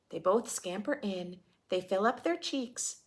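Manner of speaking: put-on lively read-aloud for kids; children's story narration; storybook reading. A narrator's reading. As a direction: read the rhyme to a class. Reading picture book aloud.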